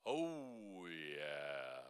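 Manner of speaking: oh sliding down into a low yeah; breathe, then drop low; low oh yeah demo. Shown as a demonstration. Coach demonstrating after a breath, sliding low. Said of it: "But breathe first".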